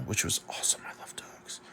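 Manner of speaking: a delighted little whisper; whispered aside. Real, not acted. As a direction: whisper it softly, as an aside about dogs. Whispered aside about dogs.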